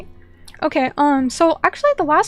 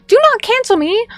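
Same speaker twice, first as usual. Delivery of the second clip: "Do not cancel me" pitched higher and louder, playful alarm; mock panic plea; 7 semitones higher, 5 dB louder than usual. Real, not acted. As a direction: beg the chat not to cancel you, half laughing. Joking plea after a mom joke.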